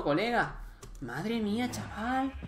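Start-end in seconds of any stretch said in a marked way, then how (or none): 1.0-2.3 high, soft altered voice; put-on amazed voice. Gamer marvelling at his own fight, mock amazed voice. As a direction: play up the amazement.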